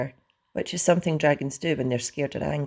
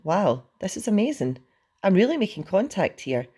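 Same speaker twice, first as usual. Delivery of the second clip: higher-pitched, amazed thought; thrilled wonder; 6 semitones higher than usual. A narrator's reading. Hiccup thinks he is bonding with dragon, amazed. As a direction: marvel with lightly raised excitement.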